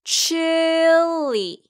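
Chile held high and long on chi; slow, high repeat-after-me Chile; drawn-out model. Shown as a demonstration. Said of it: "그럼 함께 발음해 볼까요".

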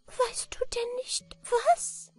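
very high, thin, faint creature question; sad little manikin. A narrator's reading. Little man asks Anna Barbara back, sad tiny voice. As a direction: ask it sadly in a tiny falsetto. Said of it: "sagte das Männlein traurig".

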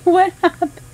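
high, loud voicing of a puzzled baby; startled baby. Baby who stopped crying looks at yelling parents, mock startled. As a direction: imitate a baby's startled little question. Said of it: "like, looking at us".

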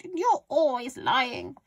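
high, drawn-out granny accusation; yelling grandma. A narrator's reading. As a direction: stretch it out, loud and accusing. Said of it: "Grandma yelled".

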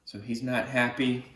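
plain reading voice

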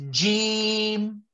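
Jim with a firm pressed j; strong jim. Shown as a demonstration. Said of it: "Make your jim strong".

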